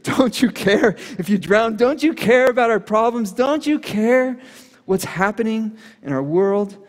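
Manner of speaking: high, loud desperate plea of frightened disciples; panicked cry. Retold or imitated. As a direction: cry out over the storm in panic.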